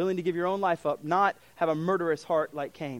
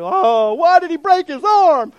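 put-on much higher, much louder whining child; whiny kid; 11 semitones higher, 14 dB louder, slower than usual. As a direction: whine like a sulking kid. Child whining about missed outing.

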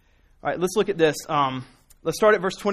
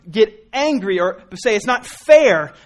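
slow, louder, leaning on each word; slow emphatic preaching; 3 semitones higher, 5 dB louder, slower than usual. Acted. Preacher warning his congregation, slow and emphatic. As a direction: drive the point home slowly.

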